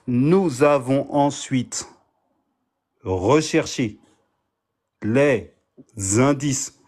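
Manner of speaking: slow, word by word with pauses; sounding out words. Shown as a demonstration. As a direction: read it out very slowly, piece by piece. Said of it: "je vais prendre du temps avec vous".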